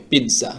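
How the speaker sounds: পিৎজা with a t sound before the z, "pit-za". Shown as a demonstration. Teacher shows the double z, t sound. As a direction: stop on t inside পিৎজা, softly. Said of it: "একটা জেডের উচ্চারণটা টির মতো হবে".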